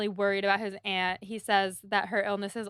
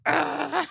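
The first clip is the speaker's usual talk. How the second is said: mock disgusted ugh; 5 semitones higher, 4 dB louder than usual. [name]'s disgusted reaction.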